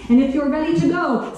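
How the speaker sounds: ordinary talk, nothing marked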